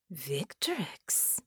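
a soft, hushed questioning Victrix; intrigued whisper. A narrator's reading. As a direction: breathe Victrix as a quiet, curious question. Baron recognizes name.

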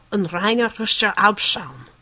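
loud guttural German villain; harsh, forceful trooper voice. A narrator's reading. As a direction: snarl the German through a speaker grill. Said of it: "came a guttural voice from the speaker grill".

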